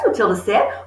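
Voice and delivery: ordinary delivery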